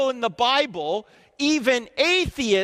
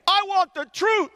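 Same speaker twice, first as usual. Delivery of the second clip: cueing the audience; 10 semitones higher than usual. Shown as a demonstration. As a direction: shout the cue high so the room answers. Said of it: "let's try that again".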